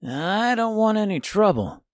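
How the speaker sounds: put-on a cool, calm boy. A narrator's reading. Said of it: "as he calmly continued".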